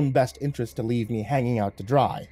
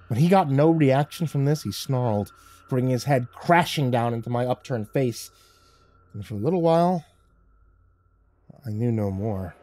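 plain reading voice

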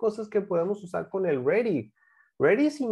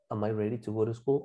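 lower, softer run-through without pauses; whole connected sentence; 9 semitones lower, 5 dB quieter, faster than usual. Shown as a demonstration. Teacher gives the whole question, low and connected. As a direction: say it in one breath, rising at "school".

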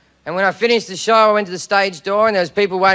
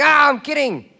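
mock backpedalling; 3 semitones higher, slower than usual. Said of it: "cover your steps very quickly by going".

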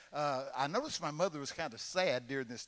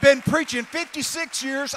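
preaching shout; 9 semitones higher, 11 dB louder than usual. Real, not acted.

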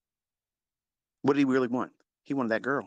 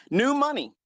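mock sneer; 13 semitones higher, 4 dB louder than usual. Sneering punchline.

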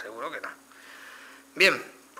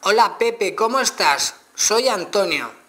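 higher and louder again, words slowly spaced; louder retry dictation; 8 semitones higher, 6 dB louder, slower than usual. Shown as a demonstration. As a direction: repeat it loudly so the phone gets it. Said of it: "vamos a verlo otra vez".

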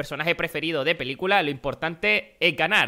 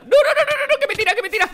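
very high, loud frantic laughing no's; panicked no's; 7 dB louder, faster than usual. Real, not acted. Gamer about to be knocked off, laughing panic. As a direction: beg not to be shot down.

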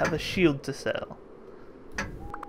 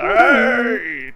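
shout; 7 semitones higher, 12 dB louder than usual. Acted. Cheering a finished trade.

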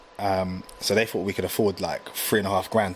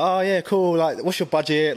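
extra nice; 7 semitones higher, 3 dB louder, slower than usual. Retold or imitated. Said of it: "I was playing it extra, like, nice".

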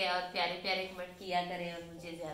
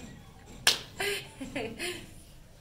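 giggle; 9 semitones higher than usual. Real, not acted. Giggles after mentioning the design.

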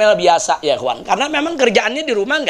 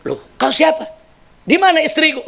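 startled question; 8 semitones higher than usual. Acted. Husband startled by old man.

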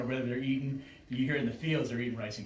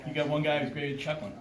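ordinary talk, nothing marked

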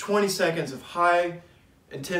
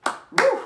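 high whooping cheer after a set; excited whoop; 4 dB louder than usual. Real, not acted. Cheer after finishing the exercise.

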